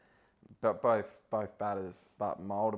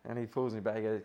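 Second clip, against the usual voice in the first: normal talking voice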